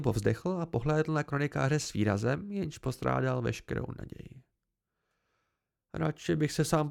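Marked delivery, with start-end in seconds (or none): none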